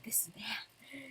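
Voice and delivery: a hushed whisper. Retold or imitated. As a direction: breathe the words out unvoiced. Quoting Invul's line.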